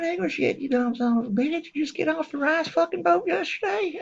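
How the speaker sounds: high, pinched mock scream; retold yell. The injured patient yelling at the doctor in a high mock scream. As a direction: yell at him in a strained high voice. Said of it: "I was screaming".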